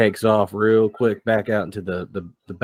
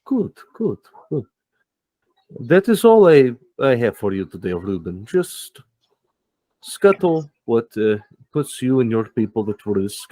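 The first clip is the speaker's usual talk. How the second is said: oily Max. Acted. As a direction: purr your approval, then dismiss him. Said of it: "And Max goes".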